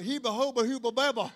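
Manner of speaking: lightly voiced mushy mumbling; Fat Albert mumble voice. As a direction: mumble it like Mushmouth. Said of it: "Talk like that guy on, remember Fat Albert?".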